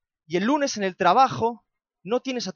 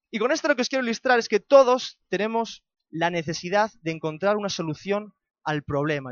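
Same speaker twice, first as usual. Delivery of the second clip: usual speaking voice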